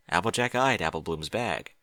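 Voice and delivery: plain reading voice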